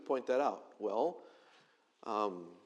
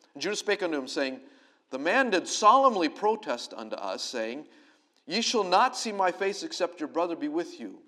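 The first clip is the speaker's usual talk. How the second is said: measured, raised reading of the text; scripture reading; 5 dB louder than usual. Acted. Preacher reading Judah's plea to the congregation, earnest. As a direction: read the passage out like scripture.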